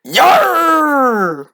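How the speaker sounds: high, drawn-out mock pirate yarr. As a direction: cry it out like a hammed-up pirate. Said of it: "ethnicity is pirate".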